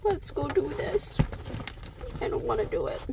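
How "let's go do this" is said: ordinary talk, nothing marked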